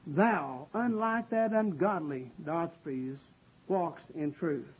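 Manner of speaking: low, slow, restrained disapproval; upset apostle, understated. Acted. Preacher imagining upset John speaking of Diotrephes, low and grave. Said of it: "was upset".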